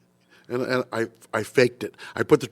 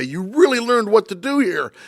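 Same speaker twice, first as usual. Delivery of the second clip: higher, slower approving praise; pleased teacher; 8 semitones higher than usual. Retold or imitated. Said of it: "He says".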